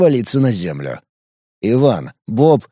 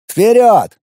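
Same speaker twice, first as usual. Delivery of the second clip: shouted command; 10 semitones higher, 5 dB louder than usual. Acted. Rider urges the group on.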